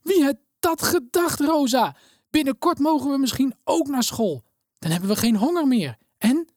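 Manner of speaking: so happy the voice cracks; voice cracking with joy. A narrator's reading. Elena cries to Rosa with a breaking, joyful voice. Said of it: "Met overslaande stem roept Elena".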